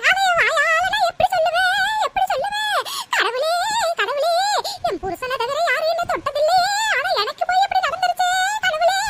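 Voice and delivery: put-on sobbing old woman's lament; hammed-up weeping, too upset to tell; tearful wailing. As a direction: cry out with long wailed endings. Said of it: "அழுதுட்டு இருக்கீங்க".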